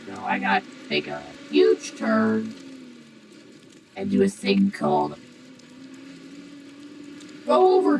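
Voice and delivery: through a voice changer. Real, not acted. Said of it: "the cool voice changer voice".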